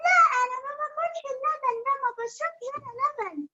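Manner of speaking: put-on high, fast, whiny child voice; little bunny voice. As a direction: squeak it like a hungry bunny. Bunny refuses milk.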